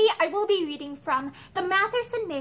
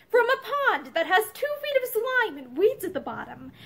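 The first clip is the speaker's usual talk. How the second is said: higher-pitched scornful sneer; 7 semitones higher than usual. A narrator's reading. Husband scoffing at his wife's excuse, sneering. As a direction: sneer at the very idea. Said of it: "Matherson sneered".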